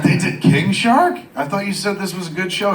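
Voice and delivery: incredulous. Retold or imitated. Man doubts friend's taste in shows, incredulous. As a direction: question it as if it's absurd.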